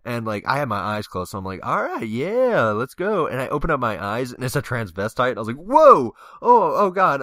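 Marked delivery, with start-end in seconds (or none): 1.6-3.3 high, slow, louder all right yeah; eager drunk yes. Retold or imitated. Drunk on the dance floor, a slow high eager yes. 5.6-7.2 loud high whoa, then oh God; shocked yelp. Retold or imitated. Opening his eyes mid-kiss, yelps loud and high.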